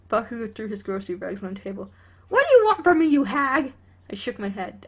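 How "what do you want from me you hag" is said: high, loud shouted retort; angry yell. Acted. Bakugo shouting at his mother about the tea, angry. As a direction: snap back at her full volume.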